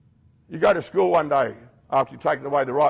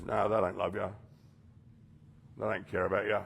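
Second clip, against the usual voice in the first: lower, softer insinuating voice; lower, softer sinister inner voice; low tempting voice; 4 semitones lower, 8 dB quieter than usual. Acted. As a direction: murmur the lie low and close. Dark voice whispers lies.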